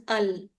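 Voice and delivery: अल as one dark syllable, not ली; sound of final LE. Shown as a demonstration. Teacher showing the LE sound, अल drawn out. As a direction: draw out अल, short vowel into a held L. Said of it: "एलई ली नहीं आता है".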